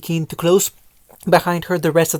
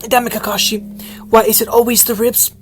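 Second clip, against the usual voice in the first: higher, whiny hurt grumble; pained complaint; 5 semitones higher than usual. Acted. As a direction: groan about the sore ribs. Jabbed in ribs, complaining.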